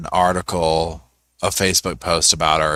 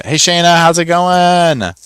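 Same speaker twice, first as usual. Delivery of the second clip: sing-song greeting; 9 semitones higher, 4 dB louder than usual. Retold or imitated. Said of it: "It's like a t".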